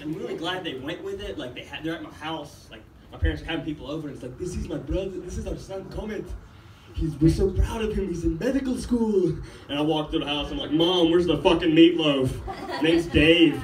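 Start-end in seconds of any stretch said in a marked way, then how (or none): 4.4-9.3 lightly voiced proud parent introducing a son; mildly hammed-up parental boasting. Parents showing off son to guests. 10.7-13.6 loud mock yell through the house; louder, hammed-up demand at usual pitch; calling to mom. Son yelling to mom at home.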